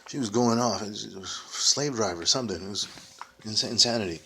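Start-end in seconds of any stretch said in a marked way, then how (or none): none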